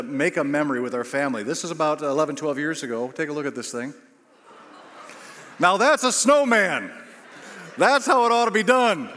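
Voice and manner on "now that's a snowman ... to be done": high, loud, emphatic boast; proud exclamation. Real, not acted. Preacher shows congregation his giant snowman, proudly exclaiming. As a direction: show it off with booming pride.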